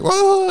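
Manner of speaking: very high cartoon "whoa"; imitated crowd yelp. Townspeople running to hide.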